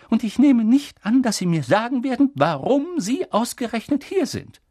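high, loud, insistent professor; McGonagall, pointed. Acted. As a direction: demand it briskly, pitched high. Narrator voices McGonagall, insistent. (hoch, laut und spitz nachgefragt)

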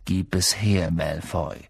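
calm, low-pitched demand; ruhig. A narrator's reading. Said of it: "sagte Harry ruhig". (ruhig, mit tiefer Stimme)

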